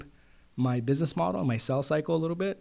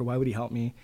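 ordinary talk, nothing marked

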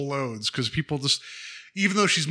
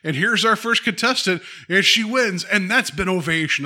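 high, loud mock announcer; show host; 8 semitones higher, 5 dB louder than usual. Host opening and closing the show, mock announcing. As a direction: belt it out like a TV host.

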